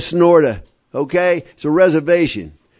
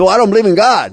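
defiant retort; 5 semitones higher, 5 dB louder, faster than usual. Retold or imitated. Retold defiant challenge.

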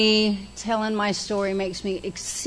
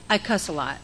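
ordinary talk

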